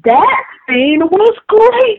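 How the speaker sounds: high, drawn-out rave; slow, high-pitched gush of delight; gushing excitement. Real, not acted. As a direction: stretch it out, gushing with enthusiasm. Gushing over a favourite scene.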